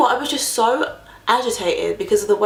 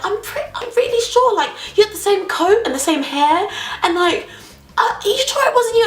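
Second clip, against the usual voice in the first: much higher, insistent man's voice; won't let it go; imitating the driver; 12 semitones higher than usual.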